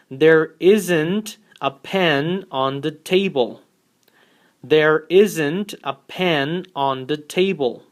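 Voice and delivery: slow, careful model sentence. Shown as a demonstration. Teacher reading the negative example slowly to the class. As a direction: spell it out slowly for learners. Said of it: "Our negative example is".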